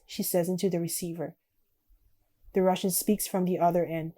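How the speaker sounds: normal talking voice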